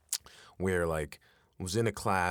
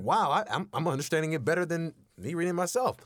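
high, louder, amazed exclaim; excited classmate; 10 semitones higher, 4 dB louder than usual. Retold or imitated. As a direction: gush like a thrilled student. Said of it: "and so it was like".

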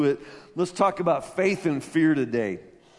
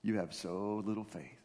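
hushed, reverent reading; 7 semitones lower, 5 dB quieter than usual. Acted. Softly closing the scripture reading.

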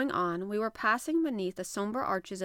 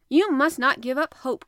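loud, higher rallying line; hearty harpooner cheering someone up, loudly; emphatic encouragement; 5 semitones higher, 10 dB louder than usual. A narrator's reading. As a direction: urge your companion on forcefully. Ned rallies doubtful Conseil.

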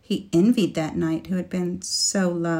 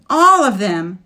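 much louder desperate cry; near scream; 2 semitones higher, 10 dB louder than usual. A narrator's reading. Woman answering the knight about her lost knights, near scream. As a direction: nearly scream it in despair. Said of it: "she nearly screamed in reply".

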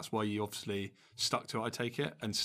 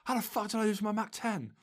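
much higher, strained disbelieving voice, mock outrage; imitating outraged opponent; 11 semitones higher, 3 dB louder than usual. Mimicking a beaten opponent raging over his gun, high mock outrage. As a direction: squeal it like a sore loser. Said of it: "He's like".

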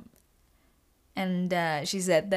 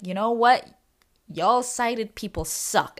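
louder, slow mock complaint; mock indignation; 4 semitones higher, 5 dB louder than usual. Telling her mom sighted people suck, mock offended. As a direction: playfully fake offended.

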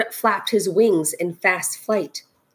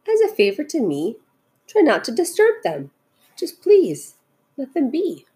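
high, earnest plea; pleading; 7 semitones higher than usual. A narrator's reading. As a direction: beg gently for a favor. Horton pleading to spare the specks.

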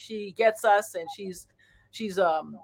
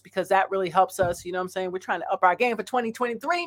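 ordinary talk

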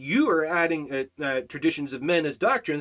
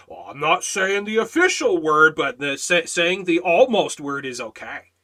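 louder, lightly acted self-justifier; mock excuse-maker; 8 dB louder than usual. Preacher mimics people excusing almost-swear words, mock self-justifying. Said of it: "And, and you say the mod word because".

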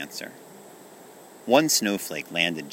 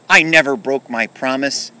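loud, raised-pitch protest; upset cry; 7 semitones higher, 11 dB louder than usual. Acted. Kenny denying he broke his promise, upset and loud. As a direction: protest hotly, close to tears. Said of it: "he began to cry".

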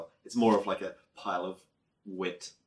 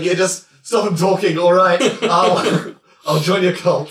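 exasperated shout; 9 semitones higher, 11 dB louder, slower than usual. Acted. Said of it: "He's like".

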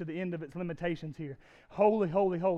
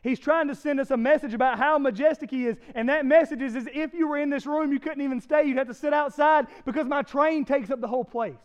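louder, higher preaching, driving the point home; full-force preaching; 7 semitones higher, 7 dB louder than usual. Acted. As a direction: preach it big and loud to the room.

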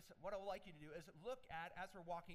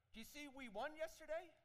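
boasting fan; 8 semitones higher, 3 dB louder than usual. Retold or imitated. Fan boasting at water cooler.

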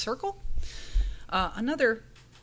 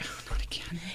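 softer, whispered resignation; weary sigh; 3 semitones lower, 8 dB quieter than usual. Retold or imitated. The coder's face after the insult, a whispered tired sigh. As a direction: breathe it out, worn down. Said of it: "she had that look on her face, like".

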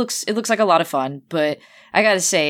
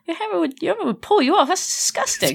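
bad New Zealand accent; 10 semitones higher than usual. Reacting to Thor's hammer line, poor New Zealand accent. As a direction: attempt a mild-mannered Kiwi, pitched way up. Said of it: "not a New Zealand voice at all".

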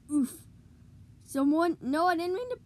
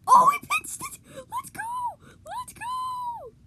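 very high excited shriek; screamed celebration; 8 dB louder, slower than usual. Real, not acted. Gamer screaming as the shot goes in, wildly excited. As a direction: shriek the cheer way up high, stretching it out.

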